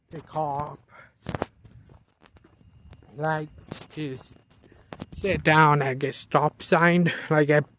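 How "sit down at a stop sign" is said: ordinary delivery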